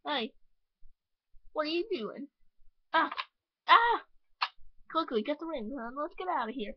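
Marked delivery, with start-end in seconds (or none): 3.7-4.0 startled cry. Acted. Thieves grab rings.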